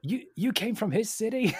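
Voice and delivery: very high, loud mock disbelief; hammed-up, stammering amazement; imitated astonishment. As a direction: squeal it like an awestruck tourist. Imitates awestruck American's reaction.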